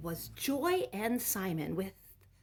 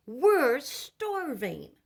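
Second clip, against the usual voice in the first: put-on hungry kids; 8 semitones higher, 11 dB louder, much slower than usual. A narrator's reading. Children tell Ma they're starving, drawn out and loud.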